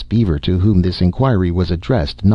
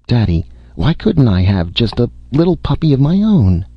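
put-on high, plaintive child's question; pleading little boy; 6 semitones higher than usual. A narrator's reading. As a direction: plead with Daddy for a puppy. Sick boy pleads with his father.

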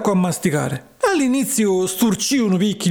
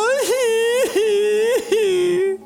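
high laugh; 13 semitones higher than usual. Real, not acted. After burnt-feet punchline.

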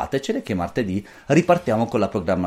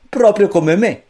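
put-on young boy; 10 semitones higher, 7 dB louder, slower than usual. A narrator's reading. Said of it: "disse il figlio maggiore".

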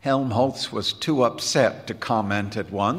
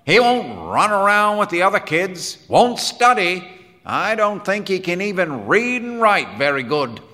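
louder, higher voice, baffled complaint; emphatic, raised-pitch disbelief; wondering Quinn; 7 semitones higher, 5 dB louder than usual. Acted. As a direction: grumble in amazement about a strange kid. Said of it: "said Quinn wonderingly".

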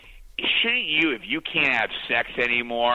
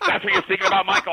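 mock scolding wife; 4 dB louder, faster than usual. Wife's retort quoted, mocking.

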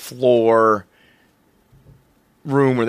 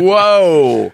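loud, drawn-out "whoa"; raised, impressed outburst; amazed reaction; 4 semitones higher, 5 dB louder than usual. Retold or imitated. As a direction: exclaim it in mock awe. Retells amazed reaction to the squawk.

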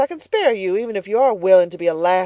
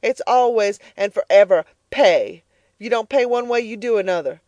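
louder, relentless harsh scolding; scornful tirade; 3 semitones higher, 7 dB louder than usual. Acted. Mrs Comstock lecturing Elnora about paying, louder. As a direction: hammer every pay home.

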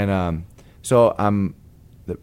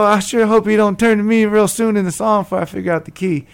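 anxious laugh; 13 semitones higher, 6 dB louder than usual. Retold or imitated. Nervous joke about missing key.

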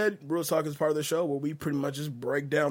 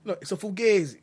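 put-on a louder, higher tough-guy Fugazi in an Italian-American accent; mock Italian-American; 4 semitones higher, 4 dB louder than usual. Host correcting his co-host with a mock Italian-American Fugazi. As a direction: lean harder into the mobster accent on Fugazi.

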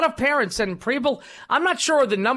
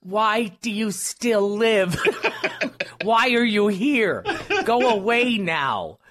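mock nagging wife; 2 semitones higher, much slower than usual. Host joins in as yelling wife.

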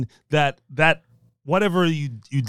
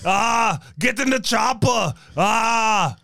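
put-on shouted, higher Austrian action-hero accent; Schwarzenegger impression; 4 semitones higher, much slower than usual. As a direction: bark it out like Arnold in a chopper scene. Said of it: "preceded by Schwarzenegger".

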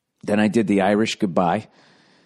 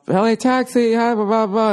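much higher, louder phone-operator greeting; high mock operator pickup; taxi dispatcher; 13 semitones higher, 4 dB louder than usual. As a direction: rattle it off like a bored dispatcher.

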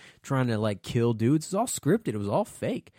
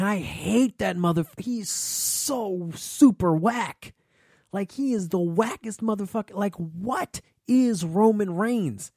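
disgusted rant; 6 semitones higher, slower than usual. Real, not acted. Ranting about Roman Reigns.

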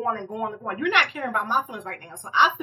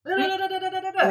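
mock ranting babble; 7 semitones higher than usual. Mimicking a boyfriend's rant.

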